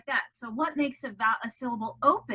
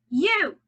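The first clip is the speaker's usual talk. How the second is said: U said loud and high; accented letter; 6 semitones higher, 8 dB louder than usual. Shown as a demonstration. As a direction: punch U. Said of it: "accent every other letter".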